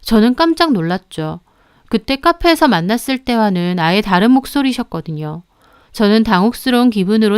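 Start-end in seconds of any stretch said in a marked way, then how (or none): none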